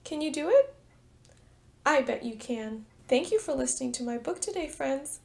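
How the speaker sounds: plain reading voice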